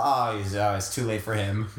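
ordinary delivery

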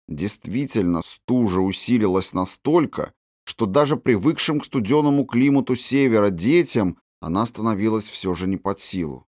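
plain reading voice

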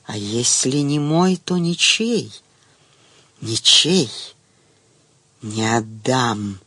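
tender whistling snake whisper; hissing whisper. A narrator's reading. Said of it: "нежный свистящий шёпот".

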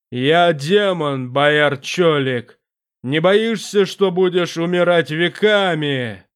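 a vast presence speaking from the dark; booming demon voice. Acted.

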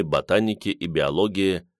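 ordinary delivery

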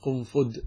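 قنفذ ending in a hard d; undotted dal ending. Shown as a demonstration. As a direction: say قنفذ as qun-fud. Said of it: "ويجوز بالمهملة يعني بالدال".

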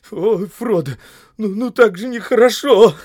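high, loud whimpering reproach; plaintive crying complaint; tearful voice. A narrator's reading. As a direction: say it trembling, close to tears. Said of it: "Дрожа, плачущим голосом проговорил Сэм".

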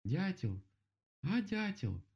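high call up a tree. A narrator's reading. Bear calls up to the woodpecker, high hailing call. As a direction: hail someone far above.

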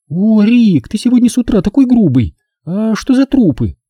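high-pitched put-on woman's voice; Nina, a playful woman. A narrator's reading. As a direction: voice a coquettish, reproachful girlfriend. (высокий женский голос, с кокетливым упрёком)

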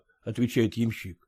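ordinary delivery

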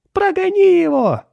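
very high, loud shriek; exclaiming. A narrator's reading. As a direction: shriek it as a frantic wife. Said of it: "воскликнула миссис Дин". (пронзительный возглас жены)

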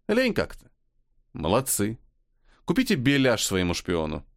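ordinary delivery

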